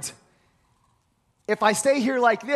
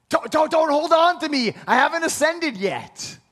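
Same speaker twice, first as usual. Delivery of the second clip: urgent plea; 7 semitones higher, 4 dB louder than usual. Retold or imitated. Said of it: "She grabbed him hard".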